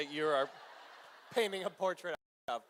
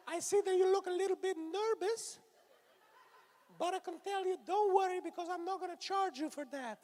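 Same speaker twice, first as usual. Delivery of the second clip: hand puppet guy voice; 9 semitones higher, 3 dB louder than usual. Acted. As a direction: play the creepy hand puppet pitching massages, pitched way up. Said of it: "he talks like this".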